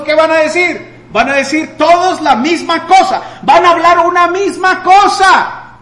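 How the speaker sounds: high, loud rising sermon climax; fervent preacher crying out; preaching at full force. Acted. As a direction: build it up and proclaim it to the congregation.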